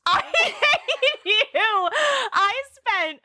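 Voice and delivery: slow, laughing mock outrage. Real, not acted. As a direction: drag it out while laughing. Teasing friend over forgotten puns.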